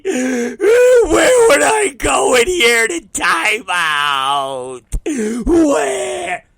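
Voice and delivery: high, loud, stretched-out tantrum bawl; wailing child. Retold or imitated. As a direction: imitate a kid screaming through tears. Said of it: "he goes like this to me".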